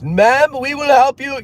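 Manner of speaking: loud high shouted reassurance; mock yelling. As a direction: imitate a call agent yelling at the top of his lungs. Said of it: "yelling at the top of his lungs".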